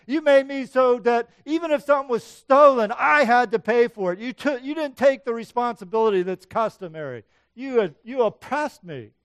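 loud, high list of grievances; angry accusation. Acted. Jacob venting at Laban over years of wrongs, angrily. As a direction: let him have it, point by point.

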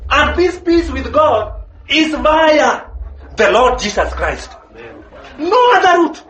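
fervent preacher pressing the point home; preaching at full force. Acted. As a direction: preach it out, driving each phrase home. Preaching climax, raised voice.

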